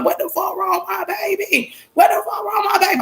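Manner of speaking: high frantic wail, re-enacted; retold panic. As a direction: pitch shoots up, panicking over a hurt child. Retold panic, running with hurt son.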